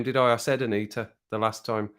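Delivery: ordinary talk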